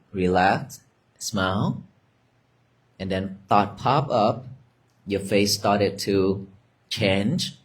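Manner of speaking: soft, low, unhurried meditation guidance; guided meditation. Acted. Teacher guiding students through breathing and thoughts, soft meditation voice. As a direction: ease them into the breath, gently.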